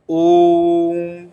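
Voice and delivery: "ओम" with the vowel held a little long; prolonged om. Shown as a demonstration. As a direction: stretch the o of "ओम" steadily. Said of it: "तीन मात्रा का समय".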